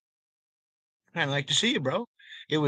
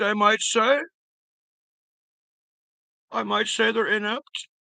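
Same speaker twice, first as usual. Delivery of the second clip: higher-pitched mock British commentator; British accent; 5 semitones higher than usual. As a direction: muse aloud in a put-on British accent. Mock British commentary after watching British films.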